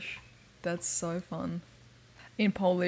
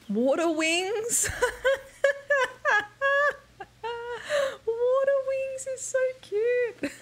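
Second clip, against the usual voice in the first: high, giggling delight; high laughing over water wings; 14 semitones higher, slower than usual. Real, not acted. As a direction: squeal over the cute word. Delighted by the term.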